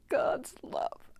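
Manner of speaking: God's love whispered, voice gone from emotion; choked whisper. Real, not acted. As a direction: barely whisper God's love through tears. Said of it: "Sorry, I'm so emotional".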